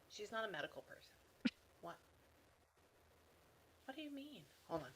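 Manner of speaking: hushed, puzzled self-talk; murmured aside. Real, not acted. Medium puzzling over the daughter's career with the spirit, murmured aside. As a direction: argue under your breath with someone unseen.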